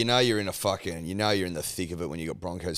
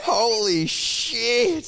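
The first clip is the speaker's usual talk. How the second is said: stunned amazement; 14 semitones higher, 4 dB louder, much slower than usual. Real, not acted. Co-host reacts in amazement.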